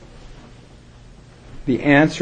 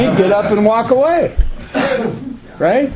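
laughing; 9 semitones higher than usual. Real, not acted. Speaker finishing his funny sponsor story, laughing. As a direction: keep laughing as you tell it.

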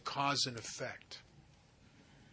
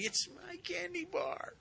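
put-on very high, softer childish whine; whiny stubborn little girl; 6 dB quieter than usual. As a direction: whine it like a sulking kid who won't give in. Stubborn girl refuses again.

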